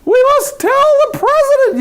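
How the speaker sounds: high, loud shrieking alarm; panicked yelling birds. A narrator's reading. Birds yelling the news at the fox, high and loud. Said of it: "yelled Chicken Licken".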